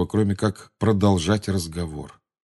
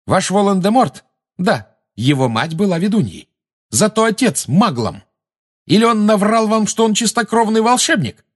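high, loud provocation; raised-voice heated jeering; defiant taunting; 13 semitones higher, 5 dB louder than usual. Acted. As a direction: goad the enemy, voice rising high. Harry taunts Bellatrix, talking over her.

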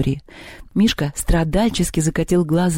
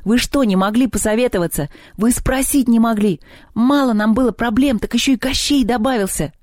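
high-pitched, exasperated scolding; heated outburst, short of full volume; breaking into shouting; 7 semitones higher than usual. A narrator's reading. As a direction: burst out at them in angry reproach. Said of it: "И вдруг сорвался на крик".

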